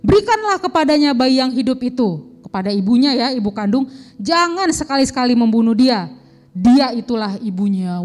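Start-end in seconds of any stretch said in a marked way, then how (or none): 0.0-2.4 loud, declamatory royal verdict; proclaimed decree, read aloud; king's ruling. Acted. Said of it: "raja menjawab". 4.2-8.0 loud royal order, slowing at the end; declaimed kingly judgement; king's ruling. Acted. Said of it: "raja menjawab".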